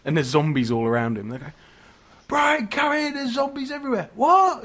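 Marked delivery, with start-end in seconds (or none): 2.3-4.1 high, strained mock yell across a distance; urgent calling out. Pensioners calling deaf friend inside from zombies, urgent mock yell. Pensioners call out to man in garden. 4.1-4.6 high, loud uncomprehending "what"; deaf old man. Acted. Deaf pensioner calling back from his sunbed, loud bewilderment. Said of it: "Because he's deaf as a post".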